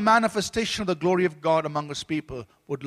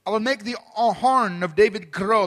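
solemn scripture reading; 3 semitones higher, 6 dB louder than usual. Acted. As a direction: proclaim each verse a little louder, like a preacher reading Psalm. Reads scripture aloud.

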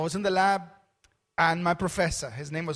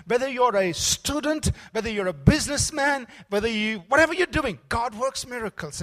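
loud, higher preaching climax; full-force preaching; 4 semitones higher, 6 dB louder than usual. Acted. Pastor rallying the congregation to believe, loud preaching. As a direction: build to a rousing close.

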